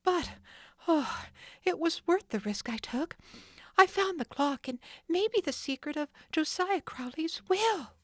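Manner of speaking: thrilled by the find; excited Nancy. Acted. Nancy exulting over the clock.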